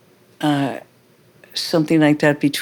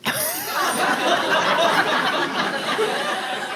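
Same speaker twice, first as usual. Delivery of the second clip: laugh; 13 semitones higher, 5 dB quieter than usual. Real, not acted. Laughing at memory.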